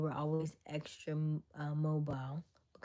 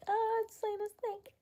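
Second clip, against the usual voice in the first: very high mock squeal; freaked-out aunt. Aunt reporting a snake on the phone, freaked out. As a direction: imitate a panicked aunt shrieking. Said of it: "so she's freaked out".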